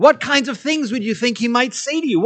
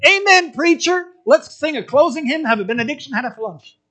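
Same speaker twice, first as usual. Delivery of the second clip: eager congregant; 6 semitones higher than usual. Retold or imitated. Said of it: "we want to say".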